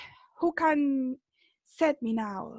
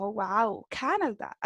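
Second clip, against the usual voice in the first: playful gringo-accented English; imitating a wowed gringo's American accent; 4 semitones lower, 4 dB quieter than usual. Shown as a demonstration. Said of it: "si ustedes le ponen el acento gringo".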